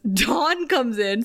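ordinary talk, nothing marked

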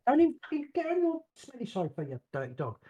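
high-pitched telling-off; exasperated pet-owner telling-off; scolding the dog. Real, not acted.

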